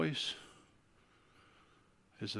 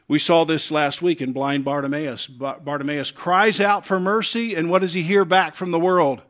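ordinary delivery

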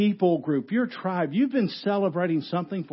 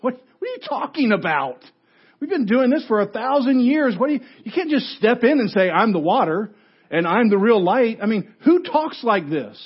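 high, raised-voice incredulous objection; indignant disbelief; 6 semitones higher, 5 dB louder than usual. Acted. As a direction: act out an outraged crowd challenging him. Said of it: "that's exactly their reaction".

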